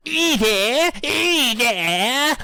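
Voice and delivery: high, squawked London greeting; comic London accent. Acted. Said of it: "that's where this accent comes from".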